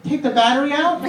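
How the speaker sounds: put-on high, unsure little girl's guess; a child proud of her answer; hopeful kid. Daughter answering dad's quiz, high and unsure.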